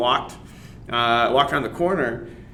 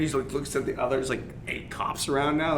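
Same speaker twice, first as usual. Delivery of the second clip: ordinary delivery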